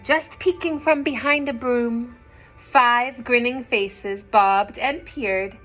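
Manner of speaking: ordinary delivery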